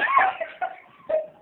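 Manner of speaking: high teary laugh-sob; real tearful laughter; laughing through tears. Real, not acted. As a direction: let the laugh break into a cry.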